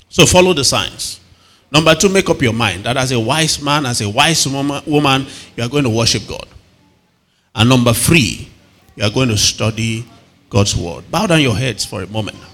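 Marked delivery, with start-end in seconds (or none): none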